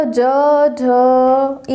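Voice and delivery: ज and झ drawn out, throat buzzing; voiced ज, झ. Shown as a demonstration. Teacher showing throat vibration to students, voiced ज, झ. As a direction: hold ज, then झ, fully voiced. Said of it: "कंपन महसूस हो रहा है".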